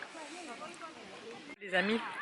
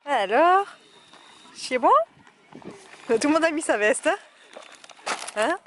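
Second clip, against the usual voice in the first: raised voice against the wind; shouting over wind; 5 semitones higher, 4 dB louder than usual. Real, not acted. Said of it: "J'espère que vous m'entendez avec le vent".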